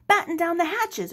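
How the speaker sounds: high, loud alarm; urgent cry. Acted. Henry shouts a storm warning to the crew, high and loud. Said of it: "Henry cried".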